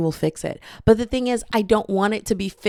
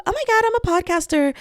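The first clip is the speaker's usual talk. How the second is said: mock excited; 10 semitones higher, faster than usual. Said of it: "like, value of".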